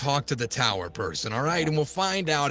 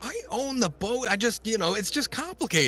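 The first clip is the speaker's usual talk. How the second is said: higher, nervous insisting; higher defensive boat owner; defensive claim; 10 semitones higher than usual. Acted. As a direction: insist on it, getting flustered.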